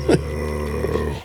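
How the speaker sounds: low, sustained sung howl; The Who impression. Bandmate showing off his The Who, held low note. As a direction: hold one low, steady note. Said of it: "That's my best The Who impression".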